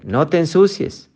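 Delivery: mock negative phrasing. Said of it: "a ver".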